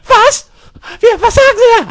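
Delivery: very high, squeaky female role voice; startled pharmacy girl. Acted. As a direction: squeal it in confusion. Pharmacy girl startled by rude customer. (piepsige, verdatterte Apothekerin)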